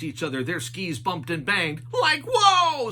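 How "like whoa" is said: high, loud goofy yelp; Shaggy yelp. A narrator's reading. Shaggy tumbling down the slope, high and loud. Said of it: "said Shaggy".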